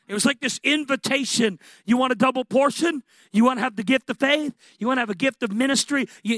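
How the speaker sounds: loud, high, driving sermon voice; fervent preaching. Acted. Preacher firing gifts at the congregation, loud and high. Rising preaching over gift list.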